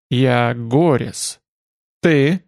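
slow, halting, gurgling voice; words forced out of a gurgling throat; gurgling creature voice. Acted. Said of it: "извлёк клокочущие звуки из глотки".

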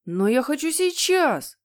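high, demanding wail; tantrum yell. Acted. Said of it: "завопил Генри". (капризный высокий вопль)

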